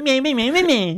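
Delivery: put-on high, loud childish me-me taunt; silly childish voice. Shown as a demonstration. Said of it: "That's what I did".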